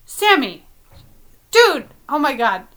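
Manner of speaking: usual speaking voice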